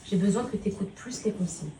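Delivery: calm him so he listens; gentle mother talk. Real, not acted. Mother talks softly to her son about school.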